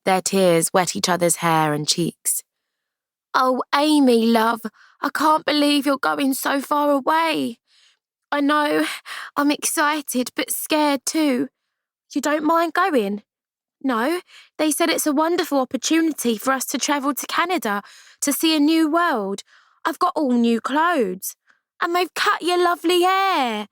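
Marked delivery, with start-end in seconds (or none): 3.3-13.2 tearful farewell. Acted. Amy tearful, higher girl voice. 13.8-23.7 put-on young girl voice. Ruth answers, higher girl voice.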